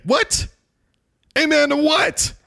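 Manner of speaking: sharp high disbelieving what; incredulous. Real, not acted. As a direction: leap up in pitch, baffled. Incredulous at announcers' amen.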